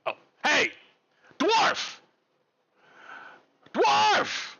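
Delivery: high, loud call toward the kitchen. Acted. Vincent calling the cook from the kitchen, loud high shout. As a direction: yell for the dwarf from across the room.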